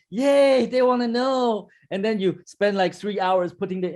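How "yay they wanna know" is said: high, gushing mock cheer; mock delight. Mimicking a seller thrilled that reception wants info, mock cheer. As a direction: act overjoyed at a small win. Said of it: "You'll be like".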